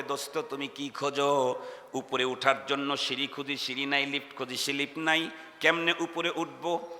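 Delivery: hushed questioning voice; quiet asking. Acted. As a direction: murmur it like a lost soul. Man asks angel, soft voice.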